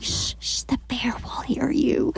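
put-on shushing whisper to a child; urgent whisper. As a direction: hush the kid in a breath. Hushing toddler near bear.

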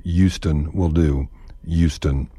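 Houston said as yoo-ston; Texan Houston with no h; silent h Houston. Shown as a demonstration. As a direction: drop the h, start Houston on a y sound. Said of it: "doesn't require the H in Houston".

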